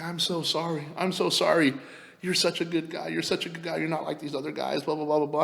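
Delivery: lightly plead, as if retelling her tearful apology; pleading, half-acted remorse; mock tearful apology. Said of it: "And she's crying now".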